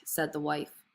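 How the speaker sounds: ordinary delivery, nothing marked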